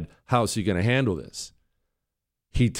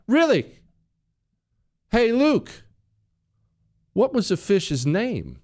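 put-on pointedly calling the boy's bluff; sarcastic challenge; 10 semitones higher than usual. Dad to son caught fake crying, sarcastic and sceptical.

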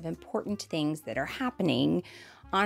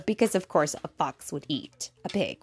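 usual speaking voice

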